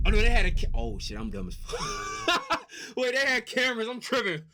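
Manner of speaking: high, loud, laughing outburst; embarrassed laughing. Real, not acted. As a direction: crack up at your own silly mistake. Laughing at own silly question.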